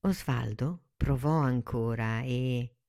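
ordinary delivery

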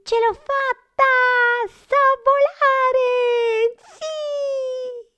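very high squealing cry of triumph; high tiny monster cheering she can fly; joyful exclaiming. Acted. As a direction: shriek with delight at finally flying. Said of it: "esclamò felice".

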